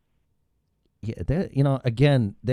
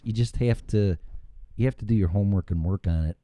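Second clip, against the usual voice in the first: usual speaking voice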